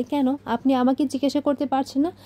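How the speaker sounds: ordinary delivery, nothing marked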